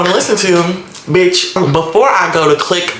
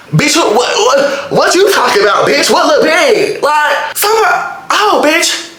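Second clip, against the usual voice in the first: indignant outburst; 11 semitones higher than usual. Real, not acted. Angry girlfriend ranting at the other woman's texts, outraged. Furious rant at the girl's messages.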